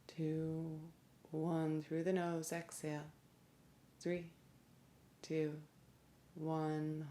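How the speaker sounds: soft, evenly spaced counting with long pauses; guided breathing meditation. Real, not acted. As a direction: count the breath softly and calmly, leaving space after each number.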